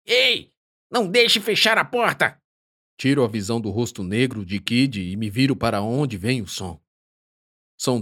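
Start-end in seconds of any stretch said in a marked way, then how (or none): 0.0-2.4 high shout from a distance. Acted. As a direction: yell to stop the door. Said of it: "ouço alguém gritando".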